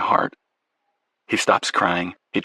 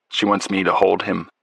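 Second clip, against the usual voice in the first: usual speaking voice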